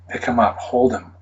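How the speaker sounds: normal talking voice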